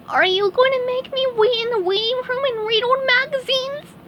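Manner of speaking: very high, anxious squeak; worried. Acted. SpongeBob asks the doctor, worried high squeak. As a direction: fret like a nervous patient. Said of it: "asked the worried SpongeBob".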